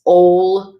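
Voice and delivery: "ol" with the long O of "roll"; long O sound. Shown as a demonstration. Teacher showing O with a fada, long oh. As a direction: give "ol" a full rounded oh. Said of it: "like in roll".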